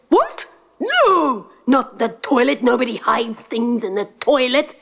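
very high, outraged yelp; insulted at the idea; indignant giant. Acted. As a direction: giant scoffing at the prince's guess.

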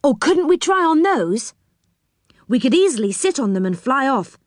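lifted, hopeful excitement; getting excited. A narrator's reading. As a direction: propose it with sudden keenness. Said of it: "getting excited".

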